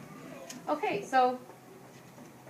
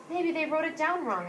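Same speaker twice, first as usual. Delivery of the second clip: put-on higher, hopeful boy voice; boy's hopeful voice; 7 semitones higher than usual. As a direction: raise it like a hopeful child. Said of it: "I say".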